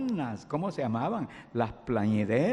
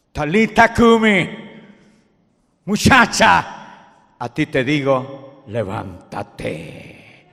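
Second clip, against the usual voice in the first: orden solemne; 5 semitones higher, 12 dB louder, slower than usual. Acted. Jesús ordena a la niña.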